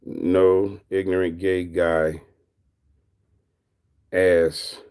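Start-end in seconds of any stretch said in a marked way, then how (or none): 0.1-2.2 slow reading. Shown as a demonstration. Streamer rereading a chatter's name, slowly. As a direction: read the chat name out slowly. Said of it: "Wait, you say?".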